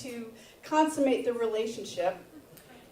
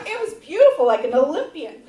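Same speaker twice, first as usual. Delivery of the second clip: ordinary talk, nothing marked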